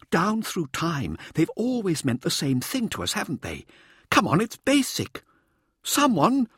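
high, insistent showboater's voice; showboating Mirza. A narrator's reading. Showboater prompts his anxious audience, high and insistent. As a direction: lecture the team in a high, theatrical voice.

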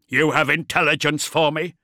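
loud, snarling demand; snarled. A narrator's reading. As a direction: snarl the question. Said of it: "Beaumont snarled".

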